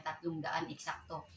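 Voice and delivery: ordinary delivery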